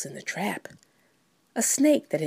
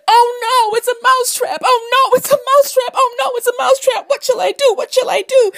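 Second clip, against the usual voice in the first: very high, loud frantic little mouse; panicked mouse; 8 dB louder than usual. Acted. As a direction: squeal the alarm over and over in a high voice. Panicked mouse voiced in story.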